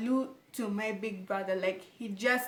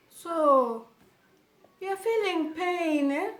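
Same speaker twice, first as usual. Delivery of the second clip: higher, slow, obvious doctor voice; mocking the doctor; 7 semitones higher, 6 dB louder, much slower than usual. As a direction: drag it out like a clueless doctor. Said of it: "the doctor cannot be more obvious".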